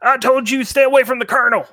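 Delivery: high, fast bandit warning; a bandit warning strangers away from the colonel; bandit threat. Acted. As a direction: fire the warning off quick and high.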